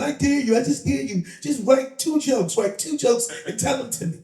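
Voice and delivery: high-pitched mimicked urging; imitating a woman friend. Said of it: "That's how she talks".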